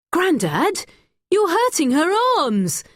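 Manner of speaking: put-on boy alarmed at his grandfather; urgent protest. A narrator's reading. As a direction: stop grandad from hurting her.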